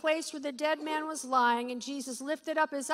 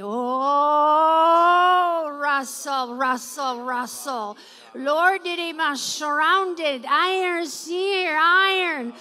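loud, fervent prayer in tongues, crying out; praying in tongues; 4 semitones higher, 9 dB louder, slower than usual. Real, not acted. Pastor leading the congregation in loud fervent tongues. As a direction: pour it out in ecstatic prayer.

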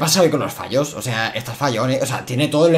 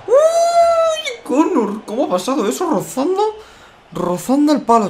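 near-miss shout; 13 semitones higher, slower than usual. Real, not acted.

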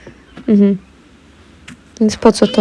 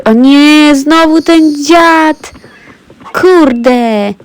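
higher, louder, drawn-out whine; exasperated dismay; 5 semitones higher, 3 dB louder, much slower than usual. Real, not acted. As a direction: groan that the pest is back, stretching the words. Same enemy turns up again. (przeciągły jęk irytacji)

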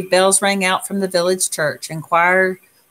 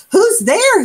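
much higher, louder startled cry; 6 dB louder than usual. A narrator's reading. Old Armadillo startled from sleep, calling at the door. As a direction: jolt awake and call out. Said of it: "woke with a jump".

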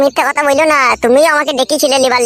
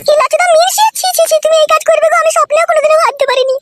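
much higher woman's voice, scolding; a much higher woman's voice, scolding and accusing; shrill village woman; 14 semitones higher than usual. Acted. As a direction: play the wife lashing out at the girl. Female character again.

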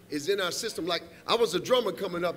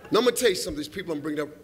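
ordinary talk

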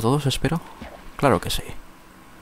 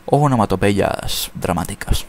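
ordinary talk, nothing marked

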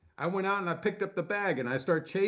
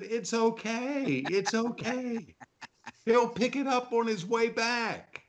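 high, slow mock reassurance, laughing; a slow, high tease to the neighbor; mock reassuring; 7 semitones higher, slower than usual. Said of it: "And I said".

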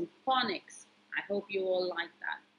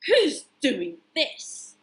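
slow, louder plea; a touch of storybook alarm; mild distressed cry; 2 semitones higher, 5 dB louder, slower than usual. Acted. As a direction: cucumber calling out in dismay. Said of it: "cried Cucumber".

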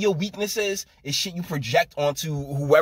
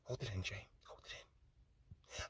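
whispered, much softer, slow; hammed-up whisper to himself, choking it down; mock holding back tears; 6 semitones lower, 24 dB quieter, slower than usual. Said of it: "let me fight back my tears".

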